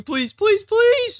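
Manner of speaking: loud pleas climbing high; urgent begging. Real, not acted. Player begs the game to teleport him, urgent begging.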